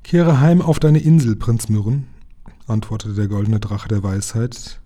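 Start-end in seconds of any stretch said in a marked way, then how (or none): 0.0-2.1 much louder, higher solemn command; the dragon sends the prince home. A narrator's reading. (gebieterische Drachenstimme)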